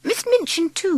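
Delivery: ordinary delivery, nothing marked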